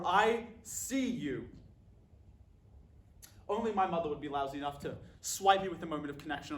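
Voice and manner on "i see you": slow quote. Retold or imitated. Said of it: "I was not ready for".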